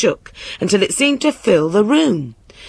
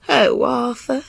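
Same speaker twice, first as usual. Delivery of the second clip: high-pitched, reproachful queen; airy wifely reproach; chiding queen; 6 semitones higher than usual. A narrator's reading. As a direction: sigh at a husband in a high voice. Queen voiced, chiding the king.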